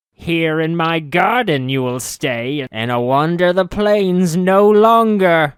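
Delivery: a clingy fairy witch refusing to let him go; high witch voice lingering on precious man; possessive witch. Acted. Witch Ravel speaks.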